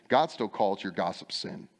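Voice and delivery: ordinary delivery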